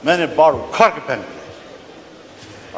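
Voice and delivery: slow, mock-proud quote of the army chief; a hint of a smug general bragging; proud boast. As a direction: boast it slowly, mimicking pride. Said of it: "फख्र से कहता है".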